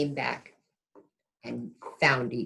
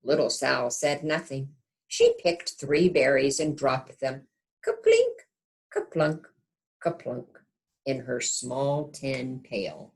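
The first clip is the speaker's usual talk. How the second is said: gentle storybook narration; reading to children; 3 dB louder than usual. A narrator's reading. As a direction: read it like a bedtime picture book. Reads picture book aloud.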